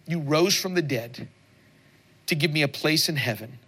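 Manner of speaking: slow prayer line by line with long pauses; led prayer. Acted. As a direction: lead the congregation through a prayer, phrase by phrase. Leads the sinner's prayer.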